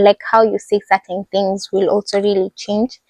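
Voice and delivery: normal talking voice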